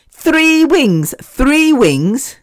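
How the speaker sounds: high, drawn-out disbelief; incredulous. Real, not acted. Podcaster complains about a tiny Nando's portion, high-pitched incredulity. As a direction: pitch shoots up in outrage at each repeat.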